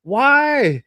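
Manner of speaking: high, loud startled yelp; mock-terrified high shout; frightened cry. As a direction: gasp it out like someone staring at a gun. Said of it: "and you're like".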